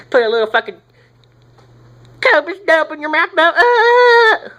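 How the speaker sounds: very high, hammed-up falsetto outburst; falsetto squeal. Jokey Copenhagen pitch after claiming Jesus likes it, shrieky falsetto. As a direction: squeak it out high, ending in a loud held "ah".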